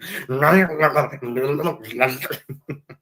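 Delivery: playful snarling dog noises; imitated dog snarl. Shown as a demonstration. Showing Martin a dog's snarl, mock snarling. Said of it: "That's what a dog does when it snarls".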